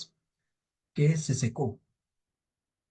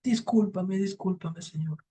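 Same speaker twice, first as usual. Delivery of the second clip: higher-pitched, softening apology; lightly acted meek pleading; apologetic old woman; 6 semitones higher, slower than usual. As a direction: placate an angry devil. Old woman placates the angry devil.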